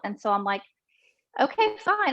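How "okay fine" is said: much higher, casual agreement; retold breezy acceptance. Patient agreeing to surgery, unworried. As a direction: lightly act out going along with it. Acting out her unworried consent.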